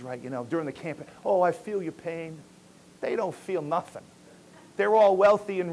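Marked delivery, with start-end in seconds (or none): 1.2-2.4 lightly voiced campaigning politician, a touch of fake sympathy; hint of a politician faking empathy; mock politician. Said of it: "you hear these politicians".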